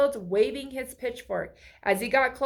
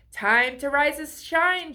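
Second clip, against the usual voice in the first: higher, louder morning call; shouting; 5 semitones higher, 5 dB louder than usual. A narrator's reading. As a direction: call everyone awake. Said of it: "she shouted".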